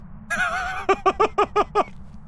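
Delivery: very high squeaky laugh; squeal of laughter. Real, not acted. Laughing at his glitching avatar, very high squealing laugh. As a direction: burst into a high squealing giggle.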